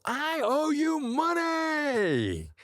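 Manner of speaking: very high, slow, sing-song cheerful cry; crazy playful voice. Retold or imitated. Caller cheerfully telling the bill collector he owes money, very high and drawn out. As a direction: sing it out to the bill collector like it's good news. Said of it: "in this crazy accommodator's playful voice".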